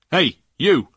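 high, loud police shout. A narrator's reading. Policeman hailing the lorry driver, shouted. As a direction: shout to get his attention. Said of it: "shouted one of the policemen".

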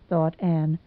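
plain reading voice

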